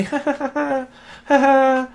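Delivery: high, put-on "ha ha" laugh; gloating mock laugh. Gloating at an ex over the upper hand, mocking laugh. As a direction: crow with a fake laugh.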